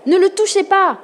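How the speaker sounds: high-pitched protective shout; loud cry. A narrator's reading. Sister defends her brother from the sea, high loud shout. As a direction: yell it to stop an attacker. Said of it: "cria Ava".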